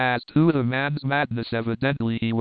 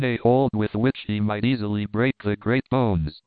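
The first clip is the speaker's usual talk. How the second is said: plain reading voice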